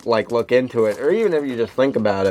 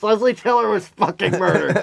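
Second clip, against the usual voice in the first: shouted quote; 9 semitones higher than usual. Retold or imitated. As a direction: blurt it out in a high voice. Repeats swear box quote.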